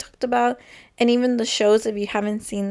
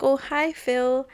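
put-on light Colombian accent, higher, "Phil" close to "feel"; a hint of Gloria's Colombian accent; 4 semitones higher, slower than usual. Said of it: "because of her accent".

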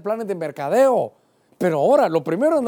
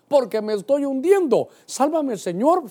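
raised, pleading prayer; urgent plea; 7 semitones higher than usual. Acted. Preacher acting a sinking man's prayer, urgent plea.

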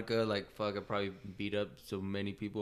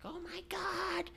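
much higher, slow shocked woman's voice; shocked lady; 7 dB quieter, much slower than usual. Retold or imitated. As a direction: squeal it like a panicked driver. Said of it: "she was like".

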